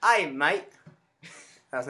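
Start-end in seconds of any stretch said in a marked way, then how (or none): 0.0-0.7 higher, louder, clumsy British accent; bad British accent. Shown as a demonstration. Host showing he can't do British accents, bad British accent. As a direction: attempt a British greeting and miss. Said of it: "You can hear my British accent".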